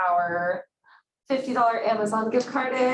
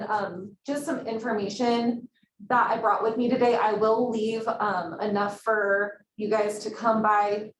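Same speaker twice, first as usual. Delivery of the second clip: ordinary delivery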